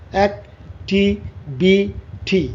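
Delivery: "Activity" slowly as অ্যাক, টি, ভি, টি; stopping at each syllable. Shown as a demonstration. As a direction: pause after every syllable of "activity". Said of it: "তুমি কয়বার তোমাকে থামতে হচ্ছে". ("Activity" প্রতি সিলেবলে থেমে, ধীরে)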